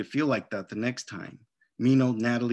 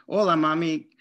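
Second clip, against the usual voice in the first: cheerful greeting; 5 semitones higher, 6 dB louder than usual. Acted. Girl greeting mom cheerfully.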